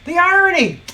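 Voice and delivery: loud, high mock-dramatic cry; hammed-up exclamation; theatrical irony. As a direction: relish it at full volume. Theatrical comment on the boss.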